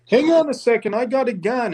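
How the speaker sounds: high mock voice; mocking a local. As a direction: ham up an eager gun-toter. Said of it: "they're like".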